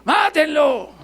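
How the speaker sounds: high, loud mob shout; crowd shouting. Retold or imitated. Disciples among the mob at the cross, shouting. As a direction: yell it like the angry crowd. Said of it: "estaríamos allá gritando".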